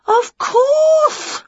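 high, loud, slow discovery; light up with the idea; delighted realisation. Acted. Witch guessing her cat is a fairy, high and delighted. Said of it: "said Winnie".

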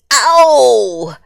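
sudden yelp of pain; pained howl. Acted. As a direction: cry out as if jabbed. Porcupine jabbed, cries out.

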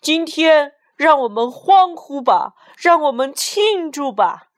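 smug mayor celebrating loudly. Acted. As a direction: gloat over the crowd in triumph. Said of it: "市长得意地说".

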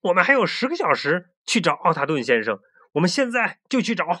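rising, quick and insistent; eager protest. Acted. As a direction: push back hard, then hurry. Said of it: "尼克挺身而出， 为朱迪辩护。".

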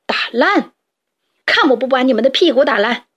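a touch of a furious father; angry threat. Acted. Father rooster threatening the boys, mildly angry. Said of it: "迪克气哄哄地跑过来".

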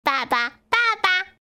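high, drawn-out little duckling voice; duckling calling. A narrator's reading. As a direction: call for daddy, high and slow. Duckling calls its new father. (小鸭子拖长声音高声叫爸爸)

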